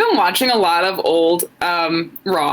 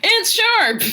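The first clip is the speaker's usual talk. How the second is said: high dopey voice; 14 semitones higher than usual. Retold or imitated. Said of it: "I was like".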